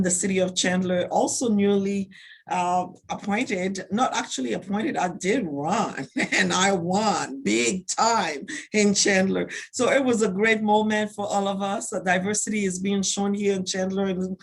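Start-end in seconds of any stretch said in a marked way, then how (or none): 5.0-9.5 slow, swooping, gleeful boast; proud emphasis. Real, not acted. Newly elected councilmember telling the panel she ran, proud and drawn out. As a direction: stretch each word out with laughing pride.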